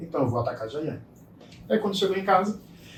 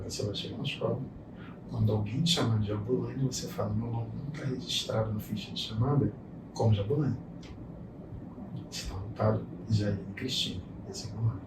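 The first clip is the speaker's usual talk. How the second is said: lower, softer steady instruction; soft fatherly coaching to his bullied daughter; calm reassuring father; 6 semitones lower, 9 dB quieter than usual. Retold or imitated. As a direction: quietly build up her confidence.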